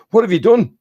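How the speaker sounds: high, loud telling-off from mum; scolding mum. Acted. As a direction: demand an answer as an outraged mum. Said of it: "your mum comes in and says".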